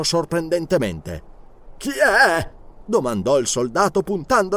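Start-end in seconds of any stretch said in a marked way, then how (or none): 1.8-2.5 startled. Acted. Startled guard challenges stranger.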